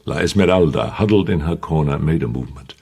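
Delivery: ordinary delivery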